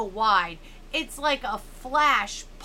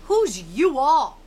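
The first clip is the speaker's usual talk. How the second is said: mean mocking; 4 semitones higher, 9 dB louder, slower than usual. A narrator's reading. Angie mocking.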